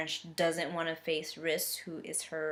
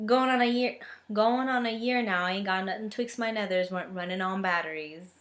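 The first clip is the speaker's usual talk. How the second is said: badly faked Southern accent. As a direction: try a hammed-up Southern twang. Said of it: "say the next line with my Southern accent".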